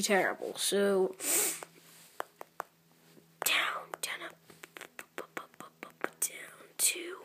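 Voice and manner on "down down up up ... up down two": soft, unvoiced murmur of each move; whispered counting. Real, not acted. Gamer whispers his moves to himself while concentrating, hushed. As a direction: whisper the moves under your breath.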